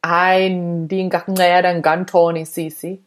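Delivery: native Xhosa phrase, with clicks; speaking Xhosa. Shown as a demonstration. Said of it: "So you get the".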